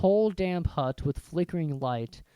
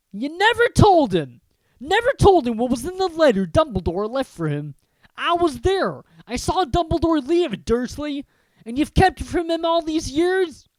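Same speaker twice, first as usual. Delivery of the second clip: loud, high furious accusation; trembling with rage; 11 semitones higher, 9 dB louder than usual. Acted. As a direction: rage at the uncle. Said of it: "his every syllable trembled with rage".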